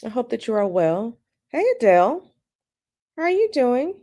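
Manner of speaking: ordinary talk, nothing marked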